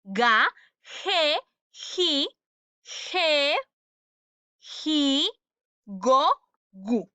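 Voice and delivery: "ge, ji" drawn out and said as "je, ji"; teacher repeats "Ga, ge, ji, go, gu" spaced out, j sound before e and i; hard g in "Ga, go, gu", throaty j in "ge, ji"; correct G syllables. Shown as a demonstration.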